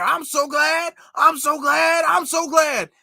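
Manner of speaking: high, loud mocking chant; mockingly imitated rally shouting; mock fiery speechmaker. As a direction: mimic a politician's over-the-top rally cry. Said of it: "putting on this very disingenuous and fake".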